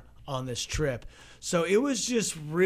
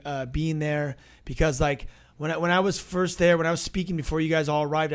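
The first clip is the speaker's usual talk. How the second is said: usual speaking voice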